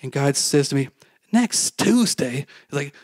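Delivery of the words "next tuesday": slow, high incredulous echo; half-mocking, scolding repeat; scolding disbelief. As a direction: drag it out as if you can't believe it. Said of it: "I felt like this kinda scolding".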